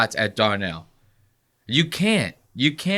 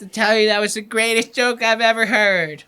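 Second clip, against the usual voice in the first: higher-pitched put-on voice of an impressed host; imitating awed praise; 8 semitones higher than usual. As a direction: gush in someone else's voice. Voicing Tony's awe at the joke.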